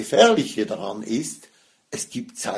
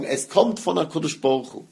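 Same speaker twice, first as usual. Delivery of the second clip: usual speaking voice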